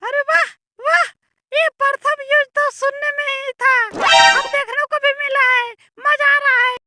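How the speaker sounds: very high-pitched delighted spectator; squeaky comic bystander. Acted. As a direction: cheer on the fight in a tiny squeaky voice. (बहुत ऊँची, पतली आवाज़ में मज़े लेता दर्शक)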